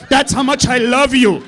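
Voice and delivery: loud declaration, swelling to a stretched finish; building dad tirade. Acted. Dad declaring love to mom, loud and combative. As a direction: shout the affection like an argument.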